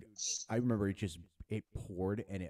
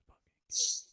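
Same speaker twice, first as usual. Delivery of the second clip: lost voice, just a whisper; no voice at all, breathe it out; voiceless whisper; 6 dB louder than usual. Shown as a demonstration. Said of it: "I lost my voice after that".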